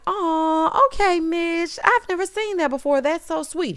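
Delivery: high, gushing group "aw"; mock-sweet gushing over a couple; cooing admiration.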